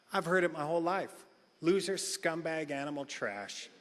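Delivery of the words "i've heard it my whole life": ordinary delivery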